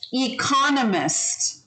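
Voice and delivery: slow, clear economist with stress on the second syllable; teacher modelling economist; model pronunciation. Shown as a demonstration. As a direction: say economist slowly, e-CON-o-mist. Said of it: "a few words to practice".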